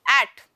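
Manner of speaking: "एट" loud, with a short vowel; short-syllable demo of "एट". Shown as a demonstration. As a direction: say "एट" as one brief, loud syllable. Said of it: "एट में छोटा pronounce हुआ".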